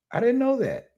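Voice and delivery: squeaky high mock astonishment; mock surprise. Vlogger feigning shock at British accents, high squeaky voice.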